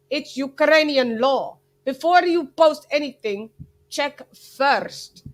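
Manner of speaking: put-on second try at a thick Ukrainian accent; Klimas. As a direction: imitate a stern Ukrainian accent, each word stressed. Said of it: "maybe I change accent".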